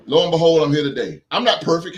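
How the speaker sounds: normal talking voice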